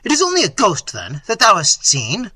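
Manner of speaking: loud, high-pitched, scornful question; angry prince pouring out contempt; raging. A narrator's reading. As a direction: rage at the foolish servant. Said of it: "cried Manfred in a rage".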